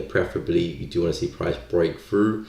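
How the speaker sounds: ordinary talk, nothing marked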